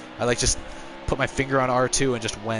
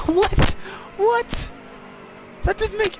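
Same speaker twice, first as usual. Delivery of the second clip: real shock; slower than usual. Real, not acted. Racer stunned by a sudden crash, high yelp. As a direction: yelp in disbelief.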